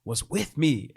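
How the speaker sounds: ordinary delivery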